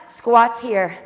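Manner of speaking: normal talking voice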